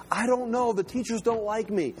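lightly acted whiny kid's protest; complaining schoolkid. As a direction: play a pupil passing the blame. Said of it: "Kids at school all the time".

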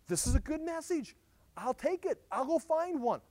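put-on keen young prophet; eager Jeremiah. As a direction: jump at the task gladly.

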